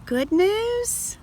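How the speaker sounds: high rising, wondering; surprised question. Shown as a demonstration. Teacher reading to children, hopeful question. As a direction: ask it in disbelief.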